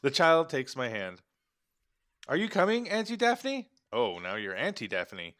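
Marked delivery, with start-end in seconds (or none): 2.3-3.7 put-on very high little-kid question; child's voice. Boy asks Auntie Daphne along, high child voice. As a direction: pitch it way up like a small child.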